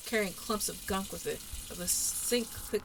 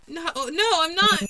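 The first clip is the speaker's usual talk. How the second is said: much higher and much louder, desperate; panicked cry; 9 semitones higher, 11 dB louder, slower than usual. Acted. Denying to the ghost in a panicked shriek. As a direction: cry out the denial.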